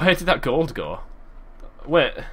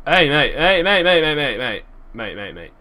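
calling out; 3 semitones higher, 4 dB louder than usual. Real, not acted. Calling out to a mob.

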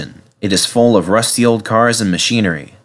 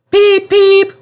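very high, loud whistle peeps; engine whistle; 6 dB louder than usual. A narrator's reading. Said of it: "whistled Edward".

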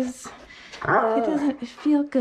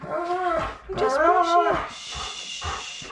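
slow soothing; 5 semitones higher, much slower than usual. Real, not acted. Owner slowly soothing a dog being brushed, drawn-out coo. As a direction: stretch the words out to calm him.

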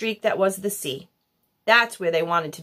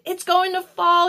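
high yelled warning; alarmed yell; 9 semitones higher, 3 dB louder than usual. A narrator's reading. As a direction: yell it across the room. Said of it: "yelled someone else".

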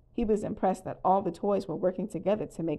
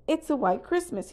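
excited shout; 7 semitones higher than usual. A narrator's reading. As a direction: announce wonderful news to everyone. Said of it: "he shouted".

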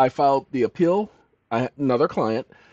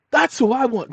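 high, louder burst of acted childish eagerness; eager child; 11 semitones higher, 4 dB louder than usual. Retelling himself at three choosing a friend, eager outburst. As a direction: blurt it out like an excited small kid.

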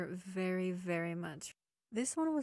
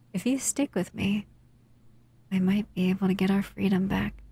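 gentle intimate roleplay voice, close to the mic; 13 dB louder than usual. Acted.

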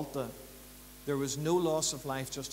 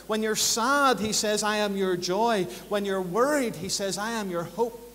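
higher, louder preaching with a driving build; full-force preaching; 6 semitones higher, 7 dB louder than usual. Acted. Pastor to his congregation, preaching loud and high. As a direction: preach it hard to the pews, rising and pounding. Litany of God's promises.

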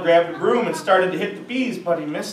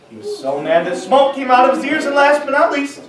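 excited; 8 semitones higher, 6 dB louder than usual. A narrator's reading. Building anger in narration.

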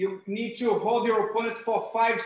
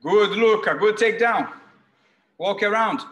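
louder praise shouted across the mat; coach calling out; 7 dB louder than usual. Real, not acted. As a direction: shout encouragement to a student. Coach praising students across room.

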